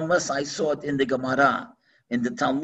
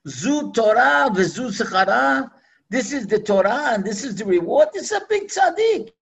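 higher, louder angelic protest; angels crying out; 6 semitones higher, 4 dB louder than usual. Acted. Angels crying out to Hashem, raised protest. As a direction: cry out in protest to heaven.

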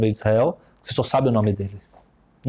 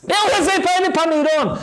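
taxi driver voice; 3 dB louder than usual. Retold or imitated. Taxi driver cheerfully greeting his bride passenger, high voice. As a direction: play the chatty Israeli taxi driver, pitched way up.

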